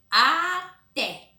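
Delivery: "Até" high and loud, pulled into two syllables; accented syllable stressed. Shown as a demonstration. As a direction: draw out "Até" to show the accent on "té". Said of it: "Qual que tem o acento?".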